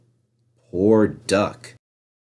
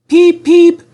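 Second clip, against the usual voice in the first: very high, loud whistle call; whistle peep; 8 dB louder than usual. A narrator's reading. The engine whistles thanks up the hill, very high. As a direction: toot it like a whistle.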